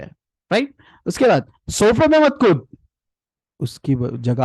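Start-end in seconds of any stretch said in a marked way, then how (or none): 1.7-2.7 much higher, sharp telling-off; harsh scolding. Shown as a demonstration. A parent scolding a child jumping on the sofa. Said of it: "आपकी voice बहुत बड़ी होती है".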